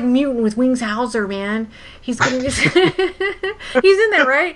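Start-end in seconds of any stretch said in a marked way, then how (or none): 2.0-4.6 high, slow, laughing through the words; giddy laughter. Real, not acted. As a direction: giggle with fangirl excitement. Giddy laughing about actor.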